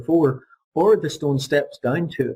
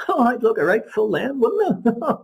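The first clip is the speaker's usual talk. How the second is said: higher, chuckling through the words; laughing; 9 semitones higher than usual. Acted. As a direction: laugh at your own joke as you say it.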